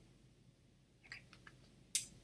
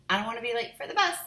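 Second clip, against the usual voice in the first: hurried; 7 semitones higher, 7 dB louder, faster than usual. Acted. Sister hurrying.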